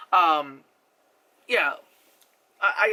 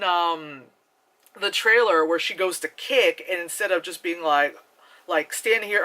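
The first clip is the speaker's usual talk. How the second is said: ordinary talk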